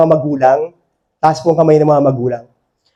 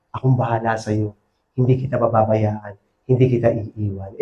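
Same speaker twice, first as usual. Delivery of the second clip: low and soft, gently reassuring; the Lord's tender voice; 7 semitones lower, 7 dB quieter than usual. Acted. Preacher voicing the Lord promising never to leave, low, soft and tender. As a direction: speak as the Lord comforting someone. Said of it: "Sinasabi ni Lord".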